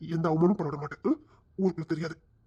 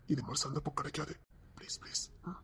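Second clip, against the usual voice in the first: breathy pleading whisper; coaxing whisper; 9 dB quieter than usual. Acted. Groom quietly coaxes the bride in a whisper. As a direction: whisper the plea close to her ear.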